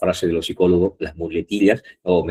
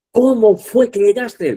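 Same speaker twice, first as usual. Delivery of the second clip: high, louder example question, CÓMO lifted and held; question intonation; 13 semitones higher, 6 dB louder than usual. Shown as a demonstration. Teacher demonstrating an interrogative to class, raised questioning voice. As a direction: ask "¿Cómo fue que llegaste?" as a loud, high-pitched demo question. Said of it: "Es una pregunta".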